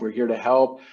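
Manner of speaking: ordinary talk, nothing marked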